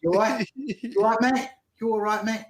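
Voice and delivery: put-on London accent. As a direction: repeat the greeting in mock London speak. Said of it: "has a very good London accent".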